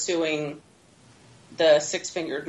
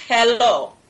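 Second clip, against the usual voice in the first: dramatic laughing movie quote; Inigo Montoya; 5 semitones higher, 4 dB louder than usual. Retold or imitated. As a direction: belt out the famous line, half laughing. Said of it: "He says".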